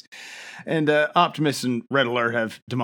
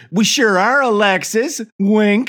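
higher, louder, teasing sing-song; hammed-up fawning kids, much higher; 9 semitones higher, 6 dB louder, slower than usual. The kids fawning over Alexis, higher and louder. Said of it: "they're like".

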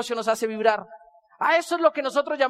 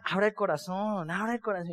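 soft urging; 4 semitones lower, 8 dB quieter than usual. Retold or imitated. Lady repeating advice softly.

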